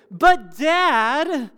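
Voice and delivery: high, loud "But Dad" complaint; whining kid voice. Retold or imitated. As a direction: whine it like a child tattling to Dad. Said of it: "it's always said in that, like, tone of voice".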